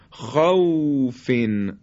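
"خوفین" slow, with an easy aw glide; soft līn. Shown as a demonstration. Teacher repeats waw after fatha in "خوفین", soft līn. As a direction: let the و of "خوفین" flow softly and long.

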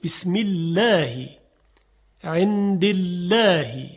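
بسم الله and عند الله slow, with a light lam; thin lam. Shown as a demonstration. Teacher gives examples of the thin lam. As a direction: recite each with a delicate lam.

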